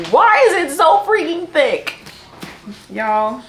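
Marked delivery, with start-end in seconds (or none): 0.1-1.9 exasperated outcry. Real, not acted. Shocked at the book's thickness.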